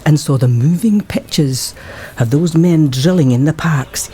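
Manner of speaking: normal talking voice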